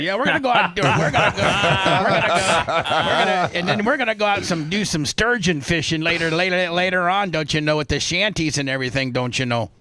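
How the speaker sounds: put-on playful Minnesota accent, leaning on "don't you know". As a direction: tease the caller with a folksy Minnesota lilt. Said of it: "it's just my accent".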